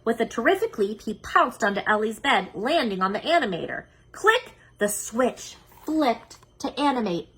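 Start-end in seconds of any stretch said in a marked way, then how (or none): 4.1-4.5 high sharp click sound. Acted. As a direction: snap out the click. Switch clicks.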